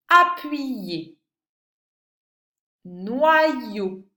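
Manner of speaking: slow, carefully spaced dictation. Shown as a demonstration. Teacher dictating words to a pupil, slow and deliberate. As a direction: give each word on its own, loud and clear.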